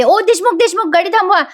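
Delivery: high, loud call to stop the car; loud excited call. Acted. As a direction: shout out to make him stop the car. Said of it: "Kavya मोठ्याने ओरडते".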